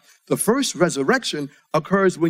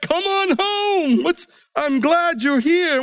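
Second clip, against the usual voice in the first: high, slow, delighted welcome; God gladly greeting a saint arriving home, high and slow; God welcoming; 10 semitones higher, slower than usual. Retold or imitated. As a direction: call them home like a happy father. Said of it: "God is like".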